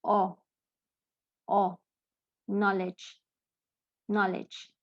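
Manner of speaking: short open "O" alone, then "Knowledge" with that vowel. Shown as a demonstration. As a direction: demonstrate "Knowledge" as "NOL-ij", no diphthong. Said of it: "the sound changes to".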